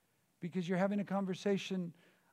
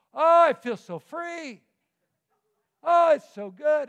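much higher and louder, slowly gushing with joy; blissful relief; 9 semitones higher, 14 dB louder than usual. Acted. A believer after God's scolding, gushing loud happy relief. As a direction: cry out the relief like you've just been set free. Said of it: "you just go".

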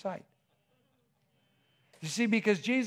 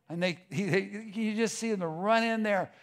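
ordinary talk, nothing marked

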